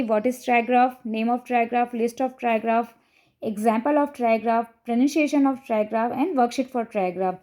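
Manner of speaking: each heading read out slowly, word by word; slow list reading. Shown as a demonstration. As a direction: spell out the questions slowly like a teacher. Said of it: "कुछ questions लिख के रखे हैं".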